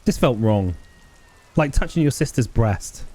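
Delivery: normal talking voice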